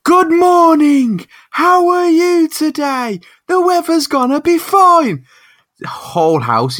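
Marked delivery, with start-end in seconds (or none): 0.0-5.3 very high, slow, booming assistant voice; loud smart speaker. Retold or imitated. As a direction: blare it like a maxed-out speaker. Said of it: "This bitch is just like".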